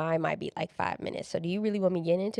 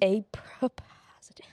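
whispering; slower than usual. Acted. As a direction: breathe the words out. Whispered echo.

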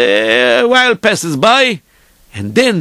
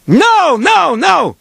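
higher, drawn-out noes; insistent rabbi; 7 semitones higher, much slower than usual. Retold or imitated. Rabbi's voice hurrying the dreamer, insistent. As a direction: urge the sleeper on with mock insistence. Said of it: "I hear the voice".

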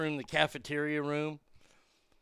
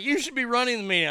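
higher and fast, urging him eagerly; excited insistence; 7 semitones higher, 4 dB louder, faster than usual. Retold or imitated. Men at the meeting urging Arnie to lead, fast and excited. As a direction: blurt it out, hurried and excited.

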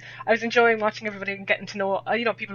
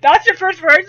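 incredulous; 9 semitones higher, 14 dB louder than usual. Retold or imitated. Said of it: "looked at me and goes".

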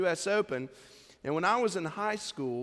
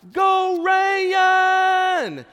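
very high, loud East Tennessee holler down the street; shouting a name; 14 semitones higher, 13 dB louder than usual. Retold or imitated. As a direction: call out across the avenue at full voice, drawn out in an East Tennessee accent. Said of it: "in her deep East Tennessee accent, shouts out".

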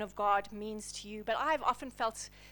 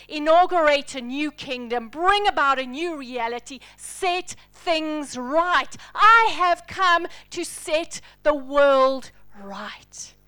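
louder and slower, ending in an intense whisper; building preaching climax; 7 dB louder, slower than usual. Acted. Preacher rallying the congregation, slow building intensity. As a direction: build the fervour line by line.

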